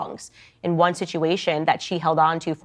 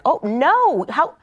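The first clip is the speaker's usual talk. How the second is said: much higher, louder pleading fan voice; mock betrayed fans; 13 semitones higher, 3 dB louder, slower than usual. Podcaster mimics Swifties questioning [name], high mock dismay. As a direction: play a heartbroken fan begging an answer. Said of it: "The Swifties were like".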